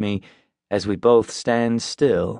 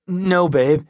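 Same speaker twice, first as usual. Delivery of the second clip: nervous; 8 semitones higher than usual. A narrator's reading. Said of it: "he says nervously".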